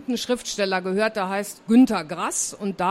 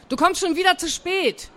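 high-pitched scolding call; 7 semitones higher than usual. A narrator's reading. Aunt tells niece she is late, calling out. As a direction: call it out high to someone dawdling. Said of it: "rief Tante Sigi draußen".

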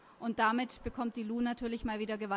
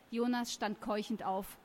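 ordinary delivery

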